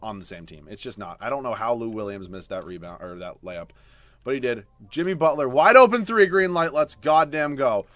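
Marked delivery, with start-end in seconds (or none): 4.9-7.9 loud, high cheering; hype cheer. Real, not acted. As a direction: cheer the shot on. Cheering a teammate's shot.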